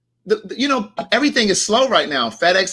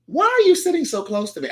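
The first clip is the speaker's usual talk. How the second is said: irritated question; 8 semitones higher than usual. Retold or imitated. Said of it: "I said it just like that".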